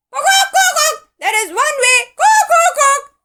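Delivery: high, loud clucking rooster. A narrator's reading. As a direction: cluck out the plan. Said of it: "said Doodle-doo Rooster".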